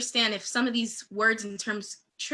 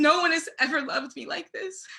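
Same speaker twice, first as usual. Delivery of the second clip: emotional; 5 semitones higher than usual. Real, not acted. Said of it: "I'm getting emotional".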